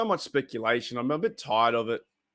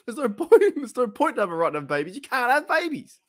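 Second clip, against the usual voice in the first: higher squeaky voice, quick, exasperated; squeaky mock quote; 11 semitones higher than usual. Fan finishing the skit quote in a squeaky exasperated voice. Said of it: "And he's like".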